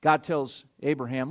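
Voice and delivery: normal talking voice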